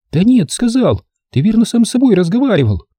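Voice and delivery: high, teasing insistence; insisting. A narrator's reading. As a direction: catch him out. Ren insists to Torak.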